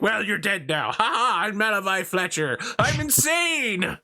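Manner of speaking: high, singsong maniac voice; hammed-up high crazed gloating; Malavai Fletcher. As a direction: gloat like a cheerful lunatic. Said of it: "just be like".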